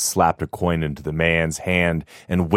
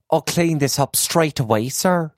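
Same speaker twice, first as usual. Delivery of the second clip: higher, eager servant's voice; a housemaid quickly offering to help; dutiful young maid; 8 semitones higher than usual. Acted. Young maid answers master.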